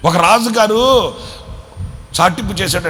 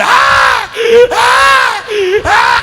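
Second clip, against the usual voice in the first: shrieking mock toddler cry, much higher and louder; imitated baby wail; 7 dB louder, much slower than usual. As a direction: bawl like a crying child. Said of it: "అంటుంటాడా".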